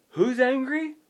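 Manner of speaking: high gasp then high who's angry; mock shocked gasp. Preacher feigning shock at the Lord's anger for his congregation.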